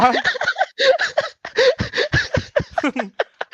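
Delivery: loud squealing laugh; high burst of laughter. Real, not acted. Laughing at rumour.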